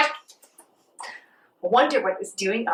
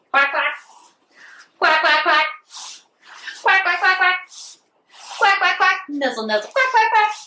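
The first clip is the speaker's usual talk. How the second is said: playful quack, quack rising higher each time; duck quacks; 6 semitones higher than usual. Acted. Teacher quacking like a duck for the class, playful. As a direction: quack like a little duck, climbing up in pitch.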